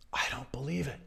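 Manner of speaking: whispered, dejected disbelief; sulking whisper. Retold or imitated. Sulking Luke whispers to Yoda in disbelief. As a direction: breathe it out, crushed. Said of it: "he was sulking".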